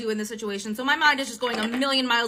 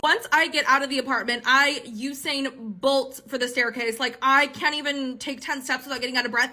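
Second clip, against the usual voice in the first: usual speaking voice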